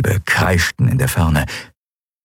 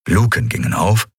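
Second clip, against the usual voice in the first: usual speaking voice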